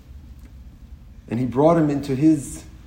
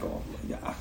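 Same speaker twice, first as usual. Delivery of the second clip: breathless whisper; 14 dB quieter than usual. Retold or imitated.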